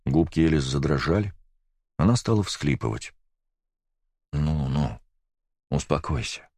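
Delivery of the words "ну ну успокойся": soft, gentle comforting voice; calm her down tenderly; soothing. A narrator's reading. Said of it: "улыбнулся ей Олейников". (ласково успокаивая)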